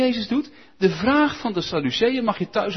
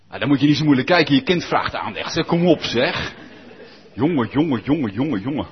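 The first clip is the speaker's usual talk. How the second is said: fast, loud joking exasperation; jongen, jongen repeated in mock despair; loud bantering; 5 semitones lower, 4 dB louder, faster than usual. Real, not acted. Preacher teasing a congregant, fast and loud.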